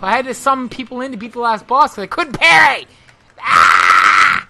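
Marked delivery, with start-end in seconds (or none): 3.4-4.5 loud sudden cry; startled shout. Real, not acted. Gamer jumped by a dog, startled shout. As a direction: yelp at the attack.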